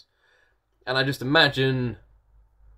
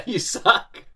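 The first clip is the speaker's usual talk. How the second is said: higher, laughing through the words; laughing tease; 9 semitones higher than usual. Real, not acted. Vlogger teasing Judd for the sad song, laughing.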